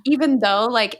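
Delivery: ordinary talk, nothing marked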